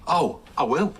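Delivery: ordinary talk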